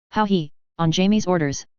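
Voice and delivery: ordinary delivery, nothing marked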